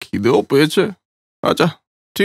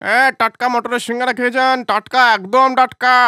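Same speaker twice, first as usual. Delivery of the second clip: hawker calling out; 7 semitones higher than usual. Acted. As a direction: sing out your fresh samosas to passers-by. Vendor calling out to customers. (হকারের মতো হাঁক দিয়ে ডাকা)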